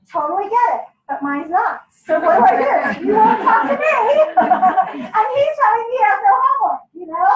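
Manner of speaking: loud, laughing exasperation; exasperated outburst. Real, not acted. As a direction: vent it, half laughing, half fed up. Venting about son hiding homework.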